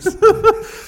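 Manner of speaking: sudden shrieking laugh; high squealing laugh. Real, not acted. Guest cracks up at his own joke, squealing laugh.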